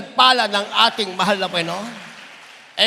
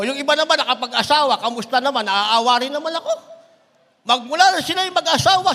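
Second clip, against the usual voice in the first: ordinary delivery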